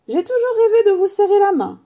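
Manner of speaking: very high, loud gushing; overexcited fan. Acted. Third customer shaking Harry's hand, loud overexcited gushing. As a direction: blurt out a lifelong dream to your idol.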